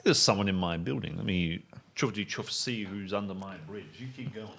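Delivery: ordinary talk, nothing marked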